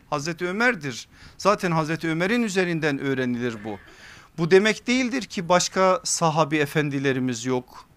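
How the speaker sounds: ordinary talk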